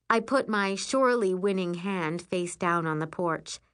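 ordinary delivery, nothing marked

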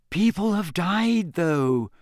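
high, anxious caution; worried objection starting high. A narrator's reading. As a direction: warn with real concern in character. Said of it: "in a worried voice".

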